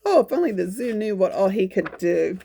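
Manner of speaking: ordinary delivery, nothing marked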